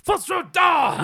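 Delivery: slow, high booming dragon shout. Retold or imitated. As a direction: bellow it like a dragon. Dragon shout from Elder Scrolls.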